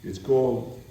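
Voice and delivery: plain reading voice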